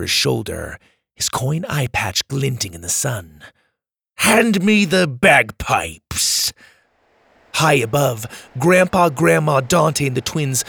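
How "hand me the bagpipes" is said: slow menacing villain. Acted. [name] villain voice.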